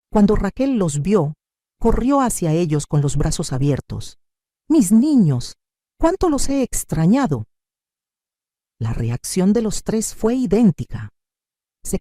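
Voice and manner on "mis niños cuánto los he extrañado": high-pitched, gushing reunion cry; effusive greeting. A narrator's reading. Mother running to her children with open arms, effusive greeting. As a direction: gush as if greeting long-missed children.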